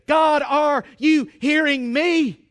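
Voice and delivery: slow, loud acted plea; anguished crying out. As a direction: cry out to God for an answer. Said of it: "I'm groaning and I'm crying".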